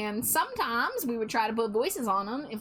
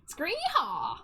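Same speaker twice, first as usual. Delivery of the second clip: mock cowboy whoop; 6 semitones higher than usual.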